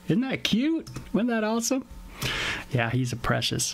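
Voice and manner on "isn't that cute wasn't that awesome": very high, delighted pet-lover voice; gushing over cuteness. Real, not acted. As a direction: squeal a little over something adorable. Gushing over pet's visit.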